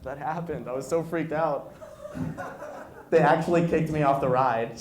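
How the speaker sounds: ordinary delivery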